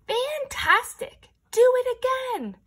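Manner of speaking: high, loud cheer, slowed on the first word; excited shout. Acted. Princess cheering the dragon after his flight, shouting. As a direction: cheer him on as loud as you can. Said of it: "Elizabeth shouted".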